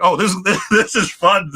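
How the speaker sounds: high, laughing delight. Retold or imitated. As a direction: chuckle through the words, thrilled. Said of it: "So I was just like".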